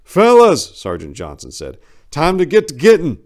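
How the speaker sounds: high, loud sergeant's call to move; rally the squad loudly. Acted. Sergeant rallies his team.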